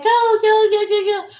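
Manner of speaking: high excited chant of go; retold excited cheering; cheering friends. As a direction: cheer her on like a crowd.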